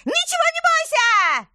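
sudden ear-splitting scream, very high; jump-scare yell at full force; deafening shout. Acted. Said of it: "оглушительно выкрикнула".

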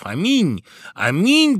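high, loud exclamation from the guests; shouted amen. A narrator's reading. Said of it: "Это закричали званые гости".

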